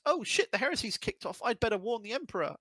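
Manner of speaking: high, rushed mock panic; alarmed Magnus. Narrator voicing Magnus resolving to warn the Emperor, mock alarm. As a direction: act out a sudden panicked realisation, pitched high.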